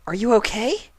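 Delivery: high, anxious question; concerned unicorn. Acted. Twilight checks on scaleless Spike, high and worried.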